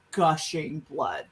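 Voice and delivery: ordinary talk, nothing marked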